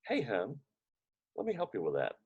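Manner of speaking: soft, kind reassurance; gentle offer. Retold or imitated. Wife gently offering to help clean up, softly. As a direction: quietly offer to help, soothing him.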